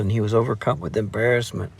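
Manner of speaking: plain reading voice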